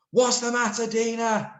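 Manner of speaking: high, loud called-out question; shouted. Shown as a demonstration. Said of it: "shouted Sid".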